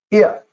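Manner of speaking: "If" pitched high and set apart; stress on If. Shown as a demonstration. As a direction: land "If" high, then pause. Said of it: "look at that first word there".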